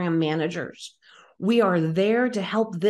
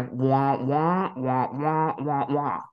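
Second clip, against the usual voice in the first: lower, droning wah wah like a muted trombone; muffled wah wah; 5 semitones lower, slower than usual. Retold or imitated. Recalling the CEO's talk to the host as a low mocking wah wah. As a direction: make the boss's talk sound like meaningless noise. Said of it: "And I hear".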